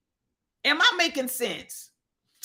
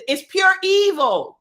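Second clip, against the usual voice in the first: higher and louder on pure evil; loud preaching emphasis; 6 semitones higher, 5 dB louder than usual. Real, not acted. Host to listeners, loud emphatic preaching. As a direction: hit pure evil hard. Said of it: "preaching emphasis".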